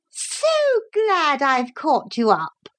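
high, drawn-out false sweetness; hateful lady. A narrator's reading. Unwelcome motor lady greeting the children, high hateful voice. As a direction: gush it high and slowly, hatefully. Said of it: "in that hateful voice of hers".

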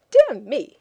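a loud startled "Dear me"; exclaimed. Acted. The Englishman giving up on her riddle, exclaiming. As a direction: blurt it out in alarm. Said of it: "he exclaimed".

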